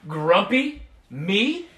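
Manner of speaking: loud, huffy protest rising on Me; a cross, loud character voice; indignant denial. Acted. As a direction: insist loudly you are not grumpy. Jim denies being grumpy.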